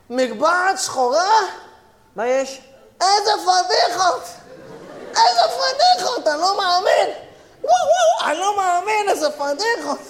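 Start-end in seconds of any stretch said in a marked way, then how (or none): 3.0-9.1 mock shock.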